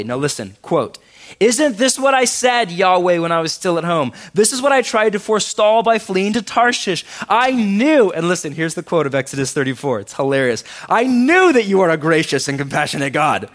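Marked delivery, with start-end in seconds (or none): 1.4-8.1 loud, higher, whiny mock lament; melodramatic Jonah. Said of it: "Melodramatic, come on". 10.9-13.5 loud, higher, whiny mock lament; melodramatic Jonah. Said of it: "Melodramatic, come on".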